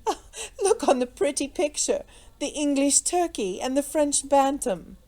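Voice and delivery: merry lady poking fun; merry laughing. A narrator's reading. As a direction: tease through infectious laughter. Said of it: "with one of her merry, infectious laughs".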